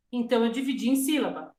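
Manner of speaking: ordinary talk, nothing marked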